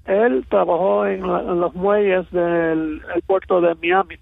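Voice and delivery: ordinary talk, nothing marked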